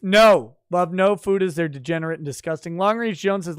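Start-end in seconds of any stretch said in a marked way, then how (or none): 0.0-1.5 sudden loud outburst of refusal; shouted no. Real, not acted. Streamer shouting down a chat hashtag, loud refusal. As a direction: bark the rejection at chat.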